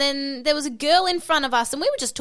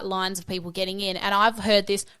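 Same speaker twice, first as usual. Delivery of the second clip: ordinary talk, nothing marked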